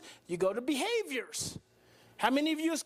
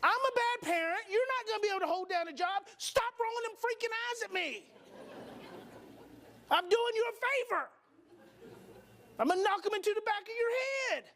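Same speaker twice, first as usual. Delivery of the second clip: high, scolding parent rant; worked-up parent; 6 semitones higher than usual. Acted. Parent to eye-rolling kid, indignant high scolding. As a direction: scold in a raised-pitch, escalating rush. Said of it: "So now it just got serious".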